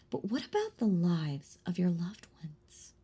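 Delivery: sinister whisper. Acted. Vampire threatening the hunter's loved ones, sinister whisper.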